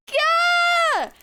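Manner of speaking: big excited yell; excited shout. Real, not acted. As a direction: shout it out with glee. Cheering over the snack.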